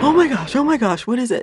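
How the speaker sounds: startled alarm in the dark; retold panic. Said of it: "shaking and freaking out".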